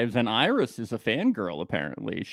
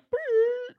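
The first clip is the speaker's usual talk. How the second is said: high-pitched excited squeal. Retold or imitated. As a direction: squeal like a crowd of kids. High squeal imitating crowd.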